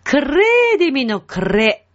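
"クレ" with a strong trilled R after the K; firmly rolled R. Shown as a demonstration. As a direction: roll the R hard in "クレーディミ" and "クレ". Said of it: "しっかり巻きます".

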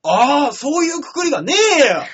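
high, loud protest; indignant retort. Real, not acted. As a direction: snap back at the joke, loud and high. Protesting being called remote. (僻地と言われて声を張り上げて抗議)

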